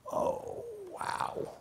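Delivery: breathless, whispered amazement; a whispered oh wow; stunned whisper. Real, not acted. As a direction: let it out as a hushed gasp of disbelief. Stunned reaction to betrayal.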